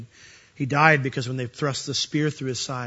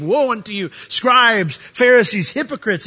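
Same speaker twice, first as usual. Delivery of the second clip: louder, higher condemning cry; scathing rebuke; 7 semitones higher, 6 dB louder than usual. Acted. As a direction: thunder it at the Pharisees. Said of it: "one of the most scathing sermons".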